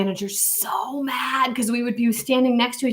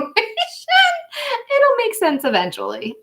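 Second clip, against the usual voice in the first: much higher, slow, laughing through it; slower than usual. Real, not acted. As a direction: giggle the words out. Laughs at her own vision.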